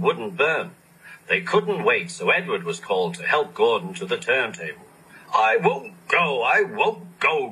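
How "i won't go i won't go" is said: grumbling. A narrator's reading. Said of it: "grumbled Gordon".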